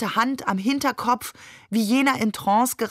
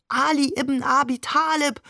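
frenzied crowd cry; 6 semitones higher than usual. A narrator's reading. Pilgrims crying out to Ali at the shrine, restrained frenzied call. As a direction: call it out like a fervent crowd, held back for reading. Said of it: "wie verrückt ... ausriefen".

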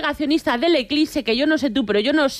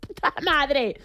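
réplica indignada un poco más aguda; suéltalo como el peor insulto del mundo; mock insulto ofendido; 6 semitones higher than usual. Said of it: "le dije, como si fuera el peor insulto". (la señora ofendida contesta al chaval de abajo)

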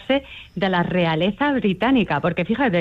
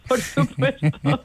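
laughing; 4 semitones higher, slower than usual. Real, not acted. Laughs while agreeing.

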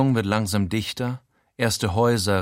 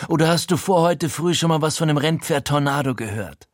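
higher, pushy voice; Frankie insisting; 6 semitones higher, 3 dB louder than usual. A narrator's reading. Frankie pushing his friend to trust him, insistent. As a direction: press him to trust you.

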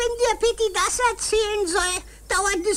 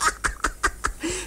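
soft chuckle; 4 dB quieter than usual. Acted. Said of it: "lachst du etwa über mich".

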